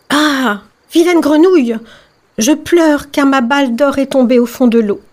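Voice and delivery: high-pitched princess, put out; upset princess. Acted. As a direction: play a princess complaining to a frog. (princesse contrariée, voix aiguë)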